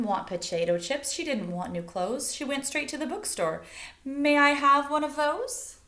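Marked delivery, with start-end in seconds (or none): 4.0-5.8 higher, louder polite request; a lightly voiced Elizabeth. Elizabeth asks the bookstore clerk, higher polite request. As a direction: ask sweetly and eagerly, a little louder.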